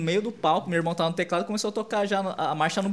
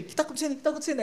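much higher pitched, baffled question; higher-voiced imitation of someone confused; retold puzzled question; 10 semitones higher, 4 dB quieter, much faster than usual. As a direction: ask what's going on, pitched high. Retelling someone's baffled question to the translator.

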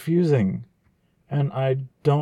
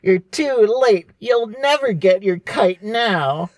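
Swiper voice; 4 semitones higher than usual. Pretend. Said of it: "he would say".

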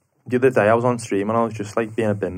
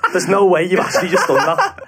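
pitched up, laughing through it; giggling at the lads; laughing disbelief; 8 semitones higher, 4 dB louder than usual. Retold or imitated. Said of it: "I was like".

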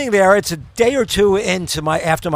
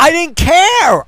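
emphatic; 10 semitones higher, 7 dB louder, much slower than usual. Real, not acted. Insists on the pain.

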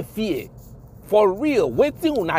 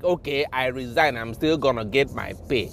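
low, soft, smug imitation of the officer; lightly voiced careless cop; mock officer; 6 semitones lower, 6 dB quieter than usual. Mocking the resigning officer, low and smug.

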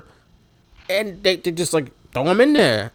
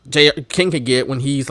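ordinary delivery

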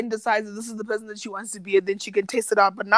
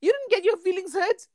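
higher pitched telling-off; indignant scolding; 7 semitones higher than usual. Pretend. Scolding the TV contestant, high and indignant. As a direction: shame him for crying.

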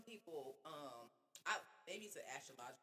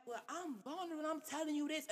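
high-pitched retold pleading; vulnerable protest; 10 semitones higher than usual. Woman replaying argument with boyfriend, vulnerable protest. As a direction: protest your openness in a raised pitch. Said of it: "I'm explaining that I'm vulnerable".

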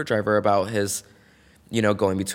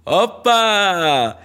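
high, loud "Oppa!" called out to cover the moment; cheeky party cry, pitched up and loud; playful shout; 9 semitones higher, 8 dB louder than usual. Retold or imitated. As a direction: belt it out to play off an embarrassing slip. Breaks glass, everyone stares.

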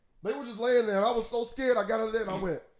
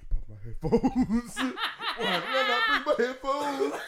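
laughing; 7 semitones higher, 4 dB louder than usual. Retold or imitated. Retelling own thought, laughing.

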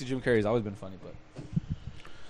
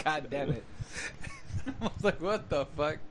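quiet, laughing through the words; can't stop laughing while quoting; 8 dB quieter than usual. Real, not acted. Retelling Mean Girls scene, giggling through the words.

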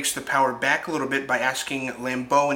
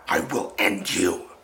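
lower, slow imitated growl of a threat; mock menacing threat; 6 semitones lower than usual. Retelling Sean choking Will, a low slow mock threat. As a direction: play the enraged therapist lunging, low and slow. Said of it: "came at him like a lion".

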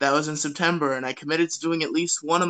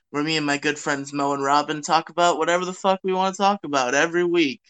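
usual speaking voice

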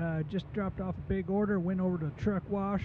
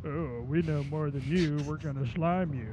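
snooty California family; 3 semitones lower than usual. Retold or imitated. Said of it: "the family from California would be like".

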